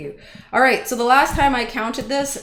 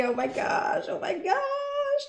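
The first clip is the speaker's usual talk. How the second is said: squealing, much higher wailing cry; anguished mock wail; 5 dB quieter than usual. Real, not acted. Streamer wailing in mock despair as chat keeps typing the wrong entry.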